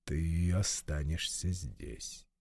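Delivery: low, slow, commanding voice of God; deep divine command, each word weighed; stern God voice. Acted. God commands Oro to stay. (властный голос Бога)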